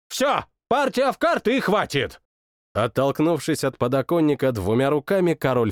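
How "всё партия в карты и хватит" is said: high, impatient royal demand; sulky king. Acted. King demands a card game, high and impatient. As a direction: cut it short.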